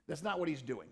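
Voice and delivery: ordinary talk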